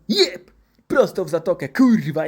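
loud high strike, then crowing; explosive mock-triumphant shout; triumphant exclamation. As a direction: celebrate a direct hit, hammed-up. Doctor hammers needle, gloats.

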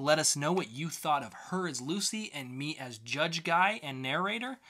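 ordinary talk, nothing marked

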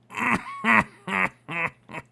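high, broken-up laughing in disbelief; high-pitched laugh. Real, not acted. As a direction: laugh in bursts at your bad luck. Reacts to another Silk Touch result.

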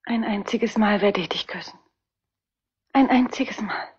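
tender secret vow; whispered promise. Acted. Woman whispers promise of a kiss.

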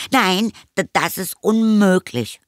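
high, stammered protest; flustered boy. A narrator's reading. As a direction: block the request in a strained child's voice. Boy blocking mother in panic. (panisch abwehrende Jungenstimme, hoch)

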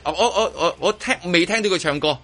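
ordinary talk, nothing marked

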